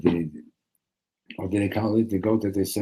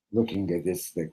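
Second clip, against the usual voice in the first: ordinary talk, nothing marked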